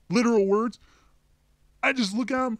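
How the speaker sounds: normal talking voice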